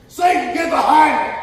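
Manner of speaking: loud high shout at Satan. Retold or imitated. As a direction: rebuke Satan at full voice. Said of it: "I scream".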